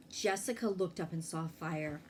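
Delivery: plain reading voice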